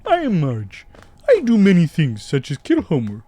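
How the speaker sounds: high, loud silly cartoon dad voice; a lightly voiced cartoon dad boasting; Homer impression. Joking cartoon voices for the stream, high and loud. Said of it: "This is my Homer impression".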